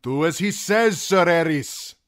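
high, loud appeal; urging. A narrator's reading. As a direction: press him urgently to obey. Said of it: "Dre urged".

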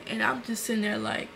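ordinary talk

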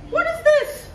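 high, loud shocked cry of disbelief; outraged shock. Acted. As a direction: shriek it out, offended by the gift. Wife outraged at the gift.

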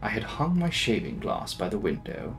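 usual speaking voice

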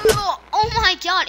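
ordinary talk

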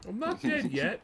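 high silly mocking voice. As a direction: echo him in a goofy high voice. Mocking teammate's line.

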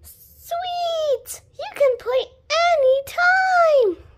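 high, excited praise with drawn-out ending; gush, stretching the last word; delighted. Acted. Thanks the players after the game, delighted.

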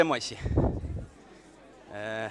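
ordinary talk, nothing marked

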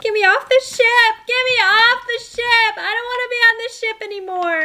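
high, wailing plea to escape; hammed-up panic; panicked pleading. Pretend panic on the haunted ship.

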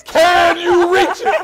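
high, slow pleading mimic laughing; imitating the caller. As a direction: squeak it out like the panicked man. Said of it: "he said".

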